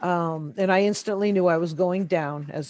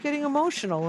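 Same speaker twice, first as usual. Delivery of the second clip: quoted question pitched much higher; quoting the man; 9 semitones higher, 4 dB quieter than usual. Retold or imitated. Recalling the UFO group man asking why she's emotional, high-pitched quote. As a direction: echo the man's puzzled question, well up in pitch. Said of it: "And he goes".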